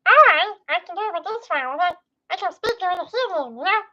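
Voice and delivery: high and a bit fast, testing the mic; lightly voiced silly character; playful voice acting. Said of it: "I'ma do some voice acting".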